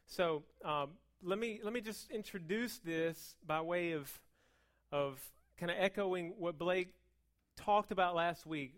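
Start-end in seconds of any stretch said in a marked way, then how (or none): none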